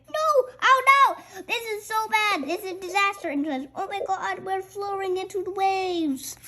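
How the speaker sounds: high frantic wailing; panicked cries. Acted. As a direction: flail through it in panic. Panic as water floods.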